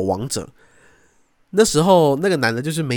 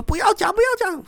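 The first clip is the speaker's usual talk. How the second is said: high, pleading voice, much higher; imitating girlfriend. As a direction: beg him to stop. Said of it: "结果他就说". (模仿女友尖声求他别讲)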